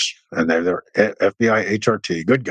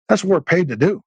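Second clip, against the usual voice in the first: indignant protest; 10 semitones higher than usual. Retold or imitated. Frustrated soldiers protesting orders.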